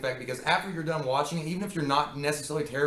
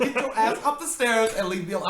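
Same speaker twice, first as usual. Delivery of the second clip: raised, higher mock scolding; mock snap; 7 semitones higher, 4 dB louder than usual. Mock scolding at the ghost family. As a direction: bark it at the ghost kids. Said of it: "I was like".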